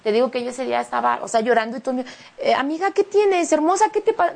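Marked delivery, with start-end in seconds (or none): 2.4-4.3 concerned classmates. Retold or imitated. Classmates asking why she cries.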